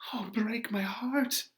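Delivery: whispered in despair; broken whisper. Acted. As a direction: let the heart break in a whisper. Juliet's grief inside her speech.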